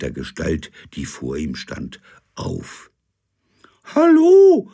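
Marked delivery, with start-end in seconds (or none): 3.9-4.7 very high, loud falsetto; Gisela's high voice. Acted. Figure at the door greets the captain, very high. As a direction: chirp it in a shrill lady voice. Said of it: "sagte die Gestalt mit hoher Stimme".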